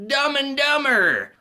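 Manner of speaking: loud, high, drawn-out title shout; title announcement. Real, not acted. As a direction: belt out the title slowly, like a big reveal.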